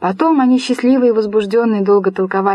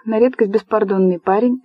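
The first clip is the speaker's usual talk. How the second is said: ordinary delivery, nothing marked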